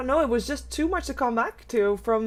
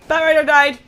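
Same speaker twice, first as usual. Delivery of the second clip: loud, high excited call; 4 semitones higher, 8 dB louder than usual. Real, not acted. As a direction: shout out the kill. Caster reacting to a kill.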